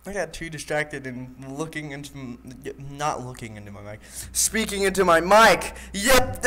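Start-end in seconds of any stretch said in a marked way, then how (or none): none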